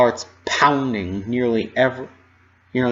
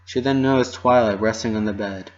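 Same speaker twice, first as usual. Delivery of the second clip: usual speaking voice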